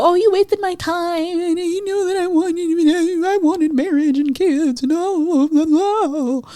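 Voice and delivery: high, slow, sing-song whining; mock them like a sulky child; whiny mock voice. Podcaster mocking daters who complain about wasted time, whiny sing-song.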